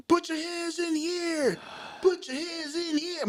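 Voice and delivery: high, drawn-out mock police yell; stretched high-pitched cop command; retold police order. Officer orders him at gunpoint.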